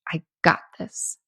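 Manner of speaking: whispered, to herself. Retold or imitated. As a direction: breathe it out as a private vow. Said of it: "Like, I'm".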